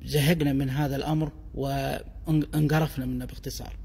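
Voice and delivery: ordinary talk, nothing marked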